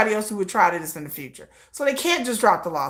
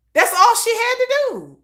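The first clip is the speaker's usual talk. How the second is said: exasperated; 10 semitones higher than usual. Real, not acted. Exasperated at Min Hee-jin.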